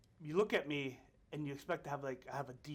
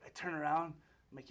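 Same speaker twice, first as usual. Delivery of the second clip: ordinary talk, nothing marked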